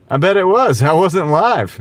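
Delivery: mock boast, pitched high; playful teasing. Streamer kidding a viewer about a boring Sunday, playful teasing. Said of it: "No, I'm just playing".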